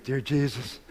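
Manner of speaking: repeating the words back hushed and unsure; new believer softly echoing his first prayer; quiet repeat. Retold or imitated. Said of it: "He goes".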